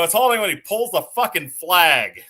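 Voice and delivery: the league president shutting down a nagging coach, loud and blunt; retold snap-back. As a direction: fire the answer back hard at the coach. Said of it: "And I go".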